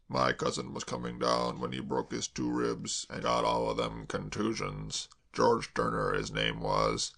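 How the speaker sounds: slow, doom-laden servant voice; gloomy manservant. A narrator's reading. Hash warns Kay about elms, slow and gloomy. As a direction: relish the danger slowly. Hash's low voice.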